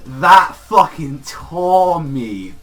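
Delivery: loud, high, drawn-out emphasis; overwhelmed fan outburst. Real, not acted. Fan moved by her crying, passionately overwhelmed. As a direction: stretch the words out with real feeling.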